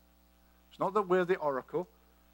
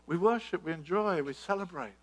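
ordinary talk, nothing marked

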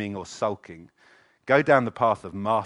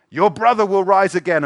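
ringing promise; 8 semitones higher, 10 dB louder than usual. Acted. Jesus promises Martha.

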